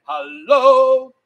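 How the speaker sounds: loud, drawn-out hello; loud greeting. Shown as a demonstration. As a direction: belt out a showy hello. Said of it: "you gotta copy me after three".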